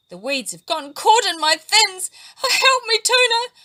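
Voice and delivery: high, loud cry for help; trapped flatfish begging Tuna; desperate plea. A narrator's reading. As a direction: beg desperately. Patiki trapped in weeds.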